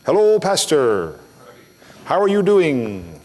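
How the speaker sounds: slow, drawn-out singsong greeting; imitating an over-eager greeter, words stretched long; Filipino caller's greeting. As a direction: stretch it out, gliding down at each end. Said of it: "a guy in the Philippines was always".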